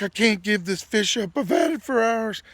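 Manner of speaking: loud, high-pitched mock plea; retold begging. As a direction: imitate your exhausted friend pleading his case. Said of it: "He's like begging me, pleading with me".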